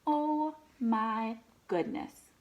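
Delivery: ordinary talk, nothing marked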